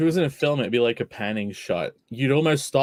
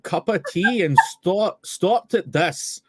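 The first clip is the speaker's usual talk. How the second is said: put-on a lightly done Scottish accent, pitched up at the start; mock Scottish reading of a fan tweet; best Scottish accent; 5 semitones higher than usual. [name] reads the tweet aloud for the show in a light Scottish accent. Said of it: "[name], do your best Scottish accent".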